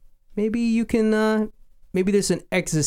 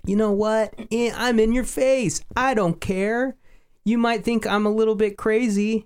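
cocky, in-your-face and higher; careless, taunting imitation; devil may care; 9 semitones higher than usual. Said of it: "devil may care attitude".

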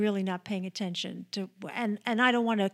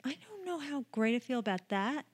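hushed, wry whisper back; whispered reply; 3 semitones higher, 6 dB quieter than usual. Real, not acted. Answering the whispered tease.

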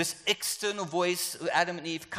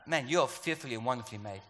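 heaven's voice; 4 semitones lower than usual. Acted. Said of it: "heaven's language is like".

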